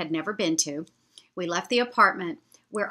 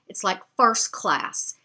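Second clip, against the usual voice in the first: normal talking voice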